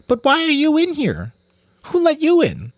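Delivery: put-on very high, loud child rat voice; suspicious girl rat. A narrator's reading. Girl rat questioning the stranger, very high and loud. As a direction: demand answers like a wary child.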